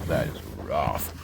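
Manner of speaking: rough said low and soft, falling; subdued, heavy-hearted murmur; low, quiet sadness. Real, not acted. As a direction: let it sink, low and quiet. Reacting again after quoted survivor.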